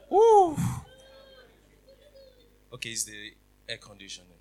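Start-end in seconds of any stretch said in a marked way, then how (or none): 0.1-0.6 loud laughing whoop. Real, not acted. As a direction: shake it off with a whoop. Shaking off emotion.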